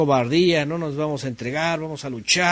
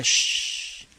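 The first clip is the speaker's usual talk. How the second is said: shush; 4 dB quieter than usual. Acted. Voicing the shushing neighbour.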